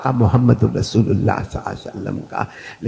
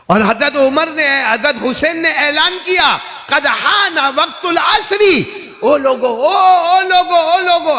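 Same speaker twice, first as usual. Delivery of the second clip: high, loud ringing announcement; full-force preaching; 7 dB louder than usual. Acted. Preacher acting Hussain's announcement of prayer time, full-force proclamation. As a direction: shout the call out across a crowd.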